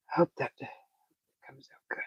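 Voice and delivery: soft unvoiced whisper under the breath; whispered hope. Real, not acted. Cook to himself over the meatballs, whispering. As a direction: breathe the words out as a hopeful wish.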